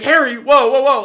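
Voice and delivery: high, loud startled shout; startled yell. Retold or imitated. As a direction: imitate a friend caught off guard, yelling. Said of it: "he's, like, freaking out".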